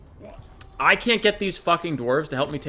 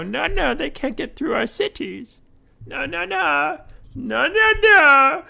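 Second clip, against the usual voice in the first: much higher, whining no no no; high whiny mock ally refusing to fight; mock whiny refusal; 11 semitones higher, slower than usual. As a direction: play a stubborn coward shaking it off. Allies voiced refusing.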